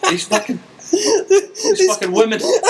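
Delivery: high comic impression; mock rant with laughter in it; Bill Burr. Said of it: "it did sound like Bill Burr".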